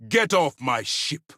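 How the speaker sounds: slow, high, emphatic command; angry dismissal. Acted. Commander ordering someone off his ship, angry dismissal. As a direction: throw them out, each word weighted.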